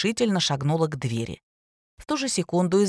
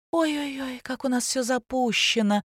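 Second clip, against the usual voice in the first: drawled mock pity; 7 semitones higher than usual. A narrator's reading. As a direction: draw it out with mocking sympathy. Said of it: "протянула Ника".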